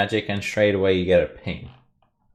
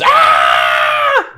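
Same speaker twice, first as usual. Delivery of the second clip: loud scream of alarm; kobold scream; 7 semitones lower, 10 dB louder than usual. Acted. Kobold facing a charging dwarf, screaming. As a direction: scream out in panic. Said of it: "that other kobold screams".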